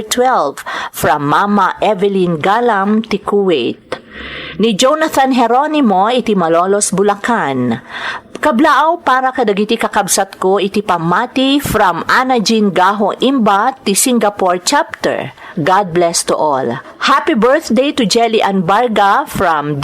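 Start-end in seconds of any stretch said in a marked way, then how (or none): none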